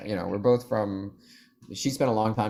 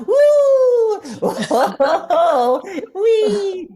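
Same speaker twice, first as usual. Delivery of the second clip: put-on gleeful squealing little boy laughing, much higher and louder; giggling six-year-old boy; 10 dB louder, much slower than usual. Shown as a demonstration. Voice actor demos a cartoon boy's giggles, gleeful boy squeal. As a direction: squeal and whoop like a delighted young boy. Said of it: "had to play, like, a six-year-old boy".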